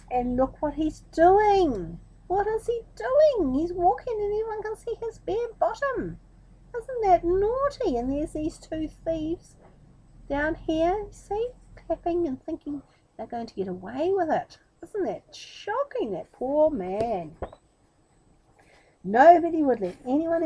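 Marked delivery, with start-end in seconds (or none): 0.1-8.0 mock scandalised aside. Real, not acted. Reader showing the child the picture, mock scandalised. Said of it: "reader exclaims to child listener". 8.0-17.4 playful mock shock. Real, not acted. Reader pointing out the thieves to the child, mock shocked. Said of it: "reader exclaims to child listener".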